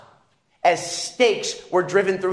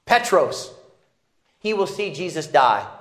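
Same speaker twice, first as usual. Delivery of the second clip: usual speaking voice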